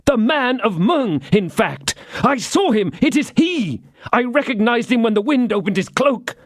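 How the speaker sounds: high, tense rush of dread and fury; feverish agitation. A narrator's reading. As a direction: pour out the fear of his evil genius. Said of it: "pale with passion".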